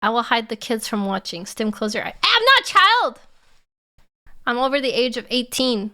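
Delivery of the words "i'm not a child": high, loud protest; indignant shout. Real, not acted. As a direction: yell it, offended. Friend told to close eyes.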